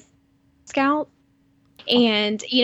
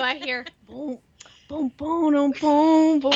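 beatbox boom; 2 semitones higher than usual. Retold or imitated. Imitating a ringtone beat.

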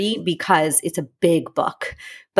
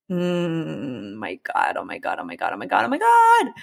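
mock dismay; 2 semitones lower than usual. Realizing she did the same thing.

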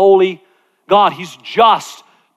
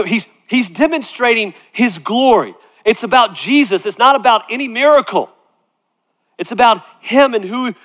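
ordinary talk

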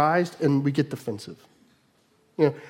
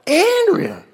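very high, loud mock holler; exasperated husband's shout, acted out; full shout; 6 dB louder than usual. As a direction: yell the name up the stairs. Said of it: "I just shout".

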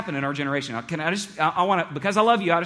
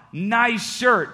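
higher, louder mock compliment; joking sarcasm; 7 semitones higher, 5 dB louder than usual. Coworker mocks Bob's shirt before everyone, joking and sarcastic. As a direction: jeer it as a joke for the room. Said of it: "in a joking, sarcastic way".

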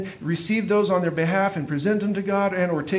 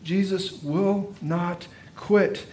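slow emphasis; much slower than usual. Acted. Preacher drives home point.

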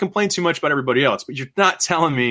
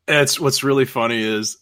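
ordinary talk

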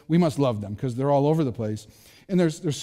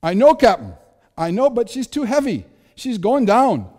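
loud, high-pitched mock Irish accent; panicked engineer shouting in a rough Irish accent; Scotty's Irish-ish accent; 8 semitones higher, 8 dB louder than usual. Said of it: "like, Irish or something kinda accent".

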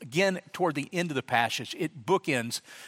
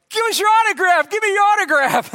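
put-on high, loud kid voices begging; excited kids; 9 dB louder than usual. Kids crowding the pastor at the gate, high loud begging. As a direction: beg like a crowd of eager kids. Kids mobbing him for autographs.

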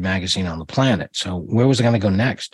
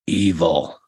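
low, raspy one-word answer, lightly done; a hint of the daredevil's rasp, clipped short; Evel Knievel; 4 semitones lower than usual. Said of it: "the way he said it with his raspy voice".

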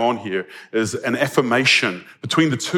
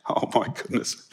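breathless whispered gasp of a shepherd; hushed startled whisper; mock alarm; 7 dB quieter than usual. Imagined terrified shepherds.

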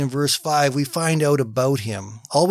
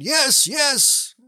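high excited yes; eager crowd cheering yes; crowd shouting; 12 semitones higher than usual. Retold or imitated. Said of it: "the crowd shouted".

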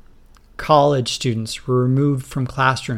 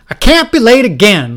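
loud and high, harried mother; frazzled mom late for school, loud and high; frantic raised voice; 9 semitones higher, 10 dB louder than usual. Acted. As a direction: yell it in a rush at the kids. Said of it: "she said".

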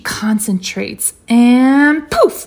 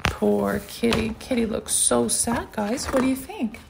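put-on soft, sorry aside to children; hushed sympathy for a sad character; sympathetic; 9 dB quieter than usual. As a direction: drop right down in pity. Sad aside about kitty to listeners.

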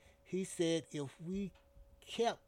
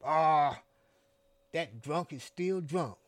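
disgusted; 8 dB louder than usual. A narrator's reading. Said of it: "like he was disgusted".

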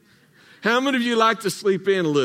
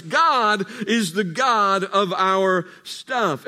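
slow, deliberate sermon punchline; slow emphatic refrain; much slower than usual. Real, not acted. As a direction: stretch every word out.

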